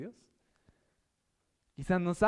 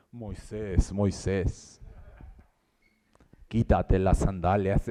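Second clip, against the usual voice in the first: deep, much lower, booming and slow; movie God voice; 9 semitones lower, 5 dB louder, much slower than usual. Pretend. Preacher mocking film God commanding Moses, booming low. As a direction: intone it like an epic film deity. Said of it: "No es como en las películas".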